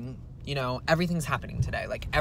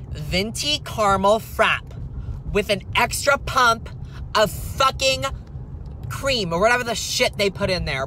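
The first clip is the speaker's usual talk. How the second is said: drive-thru order; 5 semitones higher, 5 dB louder than usual. Acted.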